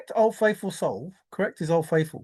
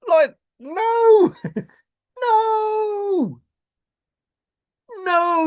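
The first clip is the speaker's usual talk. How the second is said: high, loud, half-laughing "no"; mock laughing wail; 11 semitones higher, 3 dB louder, much slower than usual. Mock wailing at being told the answer.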